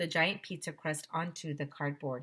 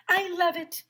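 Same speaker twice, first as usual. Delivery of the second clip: excited character line; 12 semitones higher, 5 dB louder than usual. A narrator's reading. Mister Tony's excited line.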